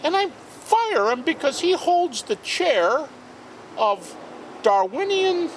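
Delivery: put-on high, slow, ranting old-professor voice; imitating Tony's way of talking. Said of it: "that's the way he talked".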